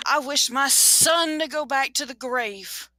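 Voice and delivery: high, slow, drawn-out command; desperate final wish. Acted. Husband makes final desperate wish.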